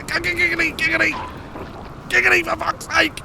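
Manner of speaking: very high, strained squeal; frantic exasperation. Real, not acted. Player calling a fighter in trouble, frantic. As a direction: squeal the name in desperation.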